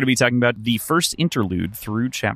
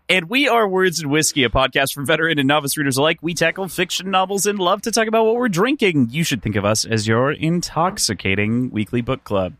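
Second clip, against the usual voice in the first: lively scripted show introduction; higher-pitched, pitching the show to listeners; podcast intro; 5 semitones higher than usual. Acted. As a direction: read the show's tagline like a practised host.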